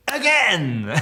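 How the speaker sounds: high, loud cry of again; excited shout. Retold or imitated. Co-star demanding another run: high excited shout. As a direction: yell it like an eager child. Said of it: "he's like".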